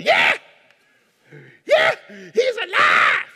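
high, loud ecstatic yelling; joyful shout. Acted. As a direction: shout it down the street like a madman. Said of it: "They would have me in a straitjacket".

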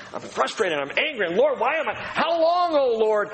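psalmist crying out. Acted. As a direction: cry out in frustration to the Lord. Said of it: "the psalmist oftentimes will just be crying out".

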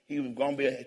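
usual speaking voice

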